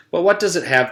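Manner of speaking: ordinary delivery, nothing marked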